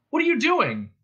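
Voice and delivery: high-pitched startled question; exclaiming. Acted. Ford to Marvin lying in the dust, exclaiming. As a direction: ask in sudden astonishment. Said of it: "he exclaimed".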